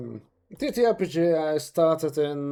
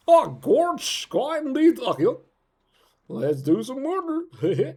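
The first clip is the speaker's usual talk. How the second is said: high, swooping, theatrical character voice; second character voice; 7 semitones higher, 4 dB louder than usual. Shown as a demonstration. Demonstrating the next voice in the range, high theatrical villain. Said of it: "from there I can go to".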